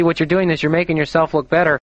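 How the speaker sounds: ordinary delivery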